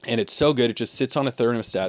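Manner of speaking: ordinary talk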